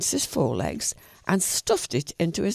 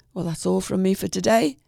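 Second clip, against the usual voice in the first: ordinary delivery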